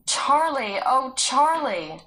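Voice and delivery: loud, high calls, the last one slow; calling out. Acted. Sarah calling for Charlie again, loud pleading calls. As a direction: call him again, pleading.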